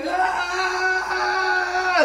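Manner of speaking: long, high agonized scream; drawn-out anguished yell; acted dying scream. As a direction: hold a high scream of agony. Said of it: "he died screaming".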